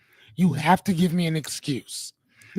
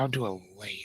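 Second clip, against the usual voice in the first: whisper; 10 semitones lower, 11 dB quieter, faster than usual. Real, not acted. Muttered aside about camera.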